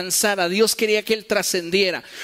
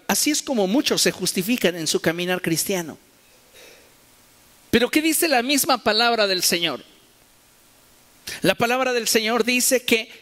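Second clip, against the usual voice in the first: ordinary delivery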